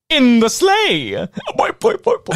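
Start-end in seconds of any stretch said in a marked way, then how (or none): 1.6-2.4 put-on high springy boy boy chant; boing catchphrase. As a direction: bounce it like a cartoon spring.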